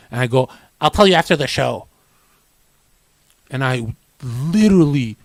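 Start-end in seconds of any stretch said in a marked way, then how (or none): 0.8-1.9 quick, louder imitated retort; cocky younger self. Younger self fobbing off curious rivals, lightly imitated cockiness. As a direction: toss it out fast as your old know-it-all self. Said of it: "I used to talk like that".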